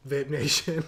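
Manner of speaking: lightly performed catchphrase shout; quoted video catchphrase. Retold or imitated.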